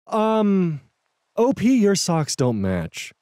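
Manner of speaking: put-on high, drawn-out mean girl tone. K remarking on a coworker's socks, mean girl tone. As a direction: point it out slowly with snide sweetness. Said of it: "with a mean girl tone".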